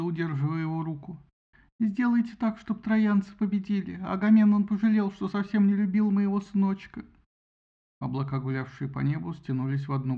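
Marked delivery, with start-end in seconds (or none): 1.8-7.0 высокая громкая мольба; умоляй со слезой в голосе. A narrator's reading. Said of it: "взмолилась Фетида". (высоко и громко умоляет)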